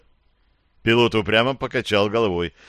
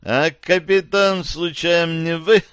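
higher, slow probing question; suspicious; 7 semitones higher, slower than usual. A narrator's reading. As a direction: ask it like a detective sizing him up. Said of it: "с профессиональным подозрением поинтересовался агент".

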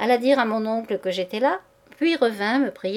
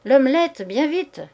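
calling out; 5 semitones higher, 6 dB louder than usual. A narrator's reading. Shouted order from other room.

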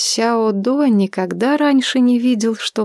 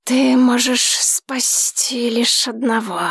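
dragon voice; 3 semitones higher, slower than usual. Acted. Dragon sets the trial.

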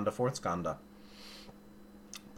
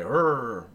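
mock angry growl, louder; 3 semitones higher, 7 dB louder than usual. Teacher acting out reaction to an insult, angry growl. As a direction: growl back as if provoked.

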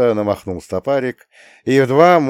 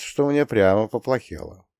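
plain reading voice

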